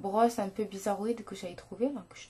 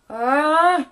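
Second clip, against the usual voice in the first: annoyed; 2 semitones higher, 10 dB louder than usual. Real, not acted. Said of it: "Ça m'énerve".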